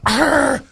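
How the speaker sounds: high mock growl of frustration; frustrated growl. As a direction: growl as if fed up with the call. Customer frustrated by order taker.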